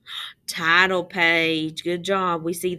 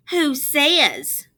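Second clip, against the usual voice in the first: defiant retort; 7 semitones higher, 6 dB louder than usual. A narrator's reading. Russell back-talking Jamaica.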